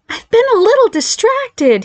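put-on very high, slow, pointed girlish voice; high-voiced, pointed understatement; massive understatement. A narrator's reading. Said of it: "he understated massively".

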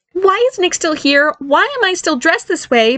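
high, drawn-out whiny complaint; complaining. Acted. Barry objects to brother's presence and his outfit, complaining. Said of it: "Barry began complaining".

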